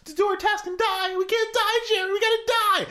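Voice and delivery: high, eager cartoon helper voice, laughing through it; Mister Meeksy voice. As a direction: squeak it up like a chipper little helper who exists to serve. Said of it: "when Mister Meeksy's like".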